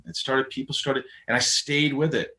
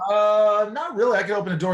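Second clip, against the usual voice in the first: high mock reply; 10 semitones higher, 3 dB louder than usual. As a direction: voice jumps high, airy and casual. His own reply, quoted.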